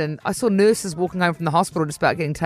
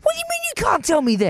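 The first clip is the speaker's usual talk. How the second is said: high, fast indignant grandma; imitating nana; 13 semitones higher, faster than usual. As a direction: rattle it off like an offended nana.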